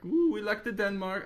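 put-on high, made-up Danish accent; mock Danish accent. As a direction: poke fun with a pretend Danish accent. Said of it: "what a Denmark accent's like".

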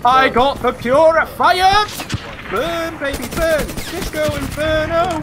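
high, loud triumphant cheer; gleeful yelling. Real, not acted. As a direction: belt it out in glee. Gleeful flamethrower celebration.

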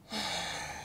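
breathy, quiet resigned sigh; soft sigh. A narrator's reading. King conceding to the quilt maker, a soft resigned sigh. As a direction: let out a long soft sigh. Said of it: "the king sighed".

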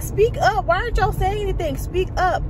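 high, urgent mock demand; imitated exasperation, pitched well up; frustrated. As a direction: demand it like a fed-up YouTuber. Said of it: "so frustrated".

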